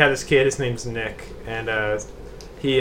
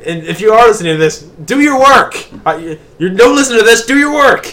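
high, loud mock nagging at Nick; mock scolding; 9 semitones higher, 9 dB louder than usual. Host shouts at absent co-worker Nick in mock scolding. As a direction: yell at him to get to work, half joking.

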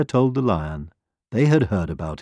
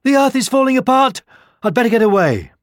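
high, loud alarm; panicked hare; 13 semitones higher, 5 dB louder, faster than usual. A narrator's reading. Timid hare fleeing what he fears, panicked. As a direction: squeal it in fright, voice pitched way up. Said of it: "he thought".

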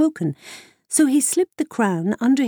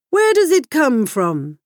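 demanding king; 5 semitones higher, 5 dB louder than usual. Acted.

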